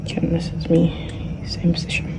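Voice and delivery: hushed whisper. Real, not acted. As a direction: keep it to a whisper. Continues whispering in library.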